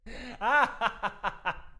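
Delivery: long giggling laugh; helpless cackle of amusement. Real, not acted. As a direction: burst out laughing at yourself. Laughs at own accent.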